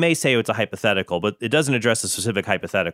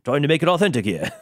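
badly faked British accent, pitched up; terrible British accent; 5 semitones higher than usual. Supervisor defends trying to make it authentic, terrible British accent. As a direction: defend it in a hammy English accent. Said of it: "that's a terrible British accent".

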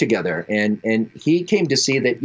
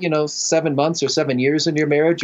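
normal talking voice

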